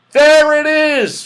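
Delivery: high, loud and slow triumphant cry; excited shout. A narrator's reading. Moonface spotting the aeroplane on the grass, shouting. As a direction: call out that you've spotted it. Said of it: "shouted Moonface".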